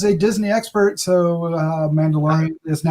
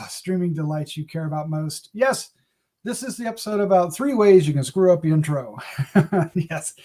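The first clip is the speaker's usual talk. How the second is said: normal talking voice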